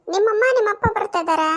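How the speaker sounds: loud mishearing questions; loud half-deaf reply. Acted. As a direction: shout back, sure he can't hear. Half-deaf wife answers loudly.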